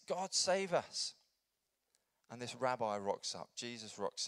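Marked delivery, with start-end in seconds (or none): 0.1-1.1 slow, high-pitched imitated plea; a drawn-out cry for rescue, without shouting; mild crying out. Thirsty people longing for a saviour, mildly crying out. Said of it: "they're crying out".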